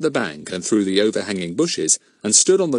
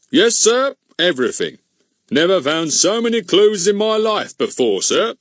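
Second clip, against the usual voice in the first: high pleased proud; 10 semitones higher, 5 dB louder than usual. Acted. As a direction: boast happily. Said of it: "pleased to see the inspector's surprise".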